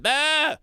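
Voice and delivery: loud high mock goat bleat. The goat answers Fish America with a loud high bleat. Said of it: "And the goat said".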